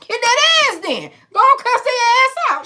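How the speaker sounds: very high, loud mock incitement; playful egging on. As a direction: cheer them on to a fight, high-pitched. Eggs viewers on to confront.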